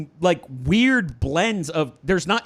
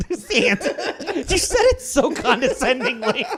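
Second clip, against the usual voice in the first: cracking up while talking; high, laughing echo and comment; 6 semitones higher than usual. Real, not acted. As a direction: talk through real laughter. Cracks up at the remark.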